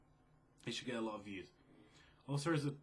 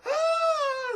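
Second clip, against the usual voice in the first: high, loud shrieking cry; old woman's shriek; 14 dB louder than usual. Retold or imitated. An old woman sees the gunman with the cat and shrieks. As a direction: scream like a startled old lady. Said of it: "this old woman who's like".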